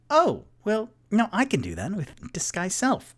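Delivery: high-pitched, swooping character voice; Rock. Acted. Rock offers to copy the disguise, high and lively. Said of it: "And then Rock goes".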